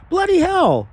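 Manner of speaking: high, loud, hammed-up cry of dismay; mock exclamation. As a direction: throw it up high in mock outrage. Mock outburst at low like count.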